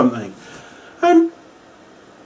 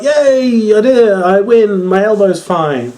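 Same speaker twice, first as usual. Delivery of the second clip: mock cheer; 6 semitones higher, 4 dB louder than usual. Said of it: "he's like".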